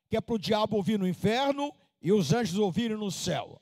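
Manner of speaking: ordinary talk, nothing marked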